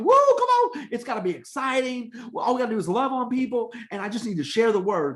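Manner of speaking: high, whooping mock hype, then rushing on; hammed-up enthusiasm, easing down as it goes; over-excited student leader.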